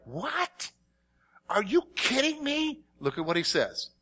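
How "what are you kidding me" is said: high, incredulous squeak of protest; mock disbelief. Churchgoer imitating the shocked crowd, mock disbelief. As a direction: play the shocked listener. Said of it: "some of you are like".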